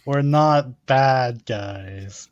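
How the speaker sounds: very slow, drawn-out declaration; slow, deliberate claim. Acted. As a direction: stretch every word out deliberately. Player contrasts claim with slow aside.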